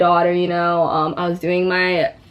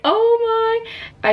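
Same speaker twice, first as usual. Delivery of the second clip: crying. Retold or imitated. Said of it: "like, crying".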